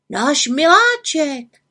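proudly name his pet ox; boy calling fondly. Acted. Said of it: "zvolal Tomáš".

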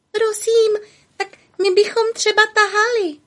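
very high, tiny timid voice; thin little voice. A narrator's reading. Tiny beetles offer to pull the cart, thin high voice. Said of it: "tenkým hláskem".

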